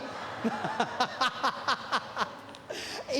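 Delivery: quiet breathy laugh; amused wheeze winding down. Real, not acted. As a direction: let out a voiceless chuckle. Laughs again.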